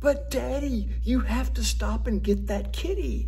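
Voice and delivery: put-on children in the back seat pleading with their father, high child voice; child's voice. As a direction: plead like a small child who won't give up. Kids begging father in car.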